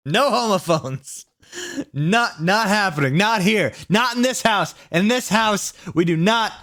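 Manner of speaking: high, slow, laughing mock proclamation; mock stern decree. Reader mocking the story with a laughing house decree.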